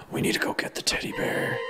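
fast urgent whisper. Acted. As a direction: whisper it, sneaking. Sneaking to the bathroom.

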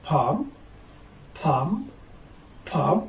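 "palm" with no L sound; teacher's careful "palm", vowel straight into m; silent L. Shown as a demonstration. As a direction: model "palm" as "pahm". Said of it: "I will start with silent letter L".

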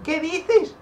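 high, loud cry of disbelief; alarmed shriek. Real, not acted. Player reacting to a shock in game, high alarmed yell. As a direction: shriek it, voice leaping way up.

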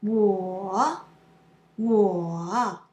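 我 stretched through a slow dip and lift; long third tone. Shown as a demonstration. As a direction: let 我 sink slowly, then rise. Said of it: "Knock your head slowly".

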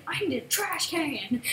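slow, drawn-out American "trash can"; a broad American voice; American accent. Shown as a demonstration. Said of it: "This is what us Americans say".

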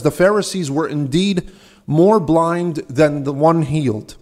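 ordinary delivery

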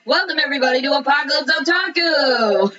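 high, excited show-intro shout; excited anime fan. Real, not acted. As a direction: hype up the opening like a fan.

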